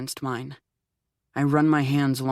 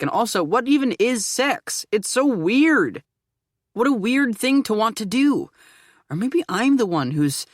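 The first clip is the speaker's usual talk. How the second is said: higher and louder, frantic disbelief; panicked spiral; 8 semitones higher, 5 dB louder than usual. A narrator's reading. Arthur panicking in his head mid-intimacy, frantic and high. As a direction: let the worry tip into near-hysterical wonder.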